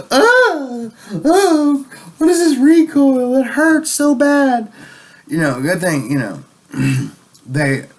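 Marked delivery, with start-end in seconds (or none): 0.1-4.7 high whiny mocking voice, much higher and louder; mock whiny liberal. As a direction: whimper it like a wimp stung by the recoil. Mocking liberals hurt by gun recoil.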